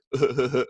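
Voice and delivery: a short, dismissive imitated chuckle; mock chuckle. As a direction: chuckle it off like an idiot. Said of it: "You're gonna chuckle?".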